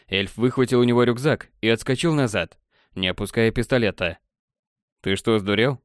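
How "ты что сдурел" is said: ordinary delivery, nothing marked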